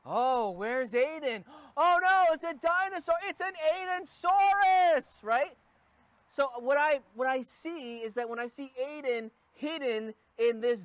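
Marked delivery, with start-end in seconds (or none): 0.1-5.2 higher, louder dad playing along with a game; hammed-up surprised father voice; playful mock alarm. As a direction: pretend fright at the little dinosaur. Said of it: "I say something like".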